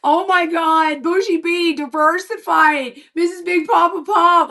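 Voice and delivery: high shouted greeting; excited calling out. Retold or imitated. Friend ringing her in hospital, shouting her nickname excitedly. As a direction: holler her nickname like a happy caller.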